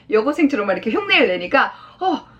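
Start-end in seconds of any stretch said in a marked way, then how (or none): 2.0-2.3 high, fast exasperated scolding; acted fed-up daughter's outburst; indignant daughter. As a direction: tell dad off in a rising girlish voice. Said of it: "이렇게 말을 한 겁니다".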